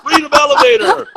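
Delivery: hammed-up high old-guy impression; older guy's echo. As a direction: squeak it back like a delighted old man. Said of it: "he's an older guy".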